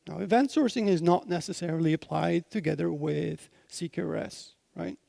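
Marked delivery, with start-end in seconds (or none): none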